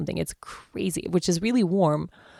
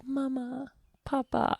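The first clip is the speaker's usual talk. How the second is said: put-on a light, higher little-girl voice; toddler voice; 8 semitones higher, 7 dB quieter, slower than usual. Mother imitating her daughter calling her parents, lightly higher.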